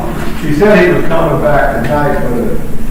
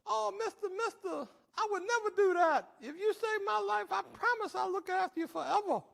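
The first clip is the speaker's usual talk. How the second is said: high-pitched wheedling snake voice; pleading snake; 21 dB quieter than usual. Acted. Snake begging the wary addict to save its life, high pleading. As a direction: promise and beg up high. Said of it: "And the snake goes".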